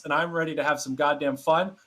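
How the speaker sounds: ordinary delivery, nothing marked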